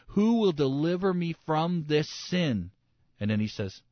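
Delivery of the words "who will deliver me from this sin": higher, slow, drawn-out plea; impassioned cry. Retold or imitated. Preacher voicing Paul's plea, impassioned retold cry. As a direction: cry out for deliverance, stretching the words. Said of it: "Paul cries out in Romans chapter seven".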